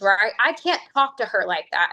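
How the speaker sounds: ordinary delivery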